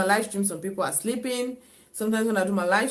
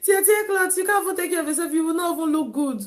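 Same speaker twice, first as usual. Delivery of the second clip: high mock voice of a teasing sister; 9 semitones higher, faster than usual. Mimicking sister who mocks her ragged clothes, high and teasing. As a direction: tease like a big sister, high and laughing. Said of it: "my sister".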